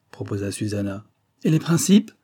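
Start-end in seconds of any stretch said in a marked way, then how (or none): 1.4-2.1 a louder, raised cry; proclaiming loudly. A narrator's reading. Marquis cries out to the family, proclaiming loudly. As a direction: cry it out like a rallying call. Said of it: "clama monsieur de Glandève".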